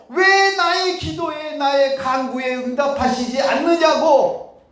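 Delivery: slow, high, loud preaching cry; impassioned cry. Acted. Preacher urging the flock to cry out, loud and slow. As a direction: cry the questions up to God at full force. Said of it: "하나님을 바라보십시오".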